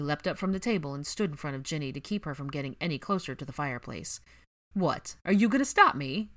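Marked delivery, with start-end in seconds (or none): 4.7-6.3 loud, high mocking challenge; derisive challenge. Ginny derisively daring Draco, loud. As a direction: sneer the dare at him. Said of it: "Ginny challenged derisively".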